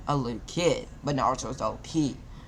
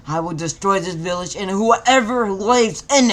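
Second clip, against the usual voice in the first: higher, louder raging threat; furious threat; 5 semitones higher, 10 dB louder than usual. A narrator's reading. Naruto vowing to destroy the village, furious shout. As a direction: spit the threat out in fury.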